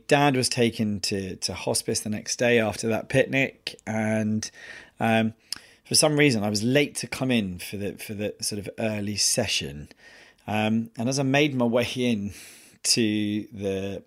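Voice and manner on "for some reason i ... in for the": ordinary talk, nothing marked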